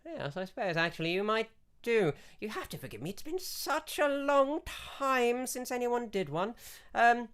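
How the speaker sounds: put-on a doddering old priestess sizing him up. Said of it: "and he goes".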